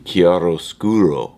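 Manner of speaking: chiaroscuro said the Italian way, slow, each syllable rolled out; Italian word. Shown as a demonstration. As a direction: pronounce chiaroscuro kee-ah-ro-SKOO-ro, slowly. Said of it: "what they say in, in Italy".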